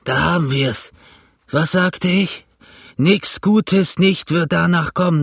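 agitated pirate crying out, high-pitched; high, insistent exclamation in character; exclaiming. A narrator's reading. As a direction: cry it out as a told-you-so. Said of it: "rief Morgan".

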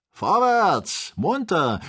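high, loud cheering-on; jubilant shout. A narrator's reading. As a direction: urge the mount on with a jubilant yell. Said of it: "schrie Joe im höchsten Jubel". (jubelnder, hoher Anfeuerungsruf)

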